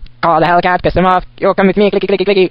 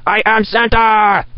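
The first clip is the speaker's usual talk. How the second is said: drawn-out proclamation; much slower than usual. Acted. Proclaiming himself Santa.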